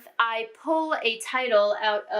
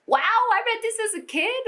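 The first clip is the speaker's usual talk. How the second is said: wide-eyed wow, half laughing at yourself; amazed disbelief; 12 semitones higher than usual. Real, not acted. Shocked adult rereading childhood book.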